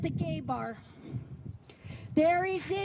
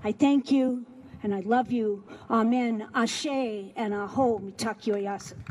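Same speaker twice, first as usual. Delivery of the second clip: sermon closing; 7 semitones lower than usual. Acted. Closes the sermon poem.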